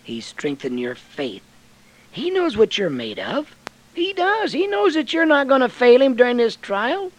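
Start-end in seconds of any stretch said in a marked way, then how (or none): 2.1-7.1 loud, high, fiery preaching of David's sling; preaching at full force. Acted. Preacher to the congregation about Goliath, fiery and loud. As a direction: belt the story out like a revival preacher.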